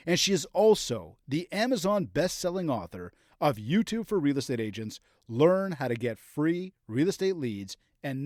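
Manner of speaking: big, upbeat podcast announcer intro. Acted. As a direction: sell the show intro like a radio host. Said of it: "I really need to step up my announcer voice so that I sound that good".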